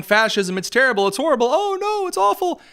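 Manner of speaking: high, whiny mock wail of despair; hammed-up high whine mocking a crybaby; mock crying. Mocking Newsom's cries of fascism with a high whiny wail. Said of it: "he's out there crying".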